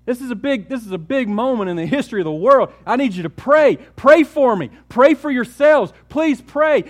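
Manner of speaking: high, loud desperate plea to pray; urgent pleading. Acted. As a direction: beg them urgently to stay awake. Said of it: "he said".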